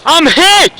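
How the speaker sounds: high, loud yell; shouting for help. Real, not acted. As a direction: call across the field. Hit, calling for medic.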